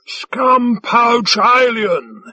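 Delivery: high, drawn-out mock outrage; slow, hammed-up shout; theatrical shout. A narrator's reading. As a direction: declaim it like a ham actor. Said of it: "he shouted theatrically".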